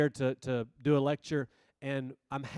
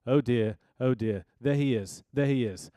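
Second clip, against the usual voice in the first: lightly imitated South African professor; fond impression with a touch of South African; South African accent; 3 semitones lower, 3 dB louder than usual. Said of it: "South African accent".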